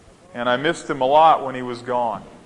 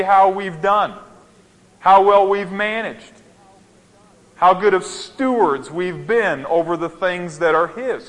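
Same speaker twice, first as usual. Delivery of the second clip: raised, urgent pulpit voice pressing each point home; fervent preaching; 4 semitones higher than usual. Acted. Sermon rising to full preaching.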